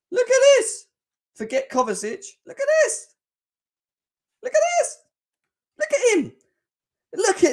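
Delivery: very high, squealing, delighted voice; excited squeal. Acted. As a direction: squeal with fan delight at the photo. Gushing over the player's cheeky smile.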